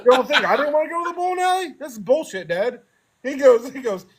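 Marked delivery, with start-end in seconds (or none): 0.0-2.8 laughing protest. Retold or imitated. Teen protesting to dad, laughing.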